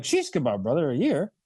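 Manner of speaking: sheesh kebab, casual mate-like disbelief; friendly wow-a-year curiosity; light curious surprise. Shown as a demonstration. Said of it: "So just go like".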